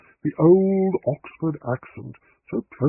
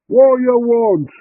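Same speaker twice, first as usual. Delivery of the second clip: slow, much higher and louder rude yell; answering shout; 10 semitones higher, 9 dB louder, much slower than usual. A narrator's reading. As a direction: bellow back from the kitchen. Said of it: "came the answering shout".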